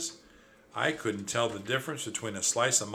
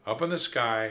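ordinary delivery, nothing marked

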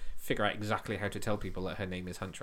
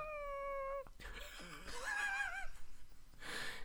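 high-pitched laugh; 10 dB quieter than usual. Real, not acted. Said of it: "really high pitch".

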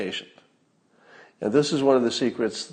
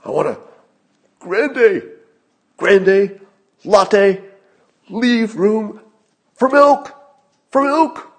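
higher, much louder, each word barked out; mocking Biden angrily barking a Starbucks order, higher and louder; mock angry Joe Biden; 11 semitones higher, 8 dB louder, slower than usual. N=Joe Biden/public. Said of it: "And Joe Biden would be like".

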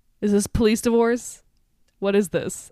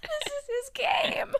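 high, slow whining voice; high whine; 6 dB quieter, slower than usual. Pretend. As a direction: whine it dramatically. High slow crying mock.

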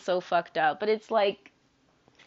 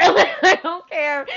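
laughing it off; 7 semitones higher, 9 dB louder, much slower than usual. Real, not acted. Laughs through the repeat.

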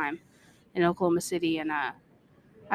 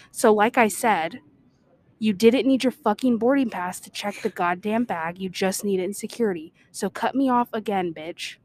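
acted-out angry comeback, louder at first; pissed-off retort; 4 dB louder than usual. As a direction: snap back as if fed up with being interrupted. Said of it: "I instantly got pissed".

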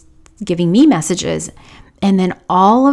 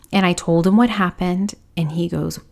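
normal talking voice

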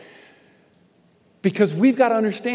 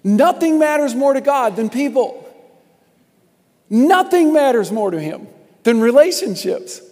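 loud, higher preaching build; booming motivational speech; 4 semitones higher, 5 dB louder than usual. Acted. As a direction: preach it out to the crowd. Preaching at full force.

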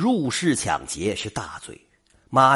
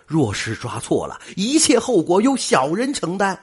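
raised, emphatic voice, utterly sure; confident assertion; 6 semitones higher, 3 dB louder than usual. A narrator's reading. Robbed man assures the magistrate he knows the culprit, confident assertion. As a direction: insist on it with full conviction. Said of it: "一脸笃定地说".

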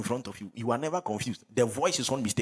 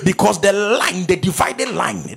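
loud, higher, driving preacher's shout; full-force preaching; 5 semitones higher, 9 dB louder than usual. Real, not acted. Pastor driving scripture home to the congregation, full-force preaching.

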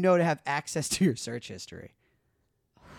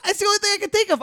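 high mock defence; 7 dB louder, faster than usual. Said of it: "He's like".

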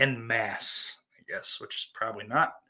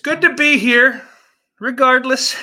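high, loud burst of cheer; loud exclamation; 11 semitones higher, 13 dB louder than usual. Real, not acted. Host welcoming himself back after ranting, loud high outburst.

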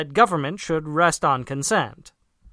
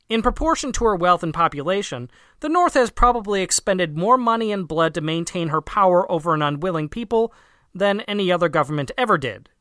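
ordinary talk, nothing marked

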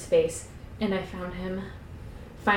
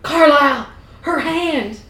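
loud, high urgent cry; alarmed call; 7 semitones higher, 8 dB louder, slower than usual. Acted. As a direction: shout for the doctor in alarm. Frightened Edward calls for help.

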